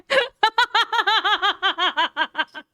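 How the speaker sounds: long, high, rapid laughing fit; real high-pitched cackling; laughter. Real, not acted. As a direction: laugh helplessly at a great punchline. Streamer cracking up at the clip.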